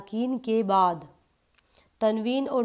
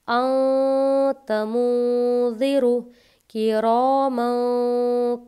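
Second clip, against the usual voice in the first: slow, louder melodic Quran recitation; fluent recitation; 4 dB louder, much slower than usual. Shown as a demonstration. Teacher recites fluently so students catch the sound, loud and melodic. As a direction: recite in full flowing tajweed voice. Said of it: "इसको अच्छे से रवानी से पढ़ूँगी".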